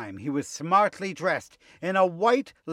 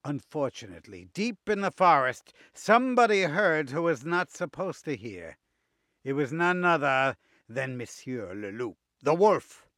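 plain reading voice

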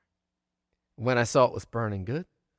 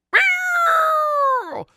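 very high, loud screeching meow; cat screech; 8 dB louder than usual. Acted. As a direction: shriek like a pouncing cat. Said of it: "and Foggy screeched".

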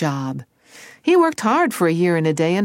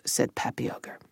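whisper; 6 semitones lower, 8 dB quieter than usual. A narrator's reading. Unvoiced narration.

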